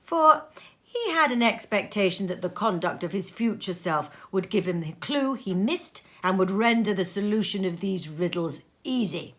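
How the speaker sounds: plain reading voice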